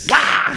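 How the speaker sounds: high "wah" yell; imitated battle cry. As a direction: yell out a wild swing. Imitates fighting with keys.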